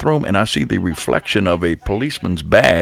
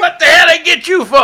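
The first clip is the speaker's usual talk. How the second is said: imitated shout; 4 dB louder than usual. Girl in the holding room hollering at him loudly. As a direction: call his name like a long-lost friend. Retelling girl's call again.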